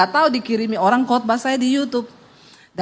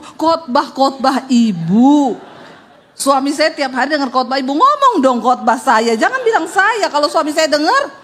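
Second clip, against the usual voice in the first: ordinary talk, nothing marked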